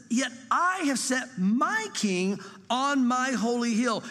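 slow, drawn-out scripture reading; slow, solemn reading of God's decree; solemn proclamation. Acted. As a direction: proclaim each word slowly and solemnly.

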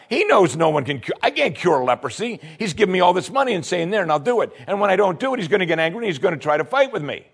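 the frightened king, rattled and fast; panicked king. Retold or imitated. King of Israel panicking over the letter, frantic.

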